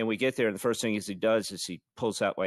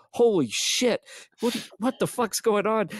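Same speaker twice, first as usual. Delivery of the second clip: higher, panicked outburst; mock panic; 10 semitones higher than usual. Passengers in the back seat, mock panic. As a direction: act out the passengers' fright. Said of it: "we're like".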